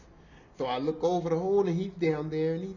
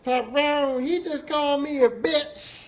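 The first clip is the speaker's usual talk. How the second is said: high, slow indignant sass; imitating a sassy coworker, pitched way up; sassy woman; 10 semitones higher, 7 dB louder, slower than usual. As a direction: put attitude in it like she's offended.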